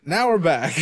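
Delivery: high, loud welcome-back; big mock intro; announcer-style return. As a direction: call it out like a show host. Announces return a third time.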